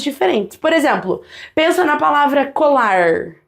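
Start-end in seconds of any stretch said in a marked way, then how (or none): 2.5-3.4 COLAR ending in a curled-tongue American-like R; retroflex R. Shown as a demonstration. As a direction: curl the tongue back on the R of COLAR. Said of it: "já denunciei, né?".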